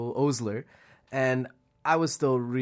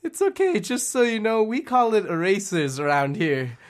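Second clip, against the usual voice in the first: kind teacher; 7 semitones higher, 3 dB louder than usual. Retold or imitated. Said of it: "It's like".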